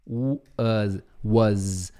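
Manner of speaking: "was" with an open a and a final z sound; "was" said "waz", a vowel and z ending; pronunciation model. Shown as a demonstration. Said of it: "Então você vai falar assim".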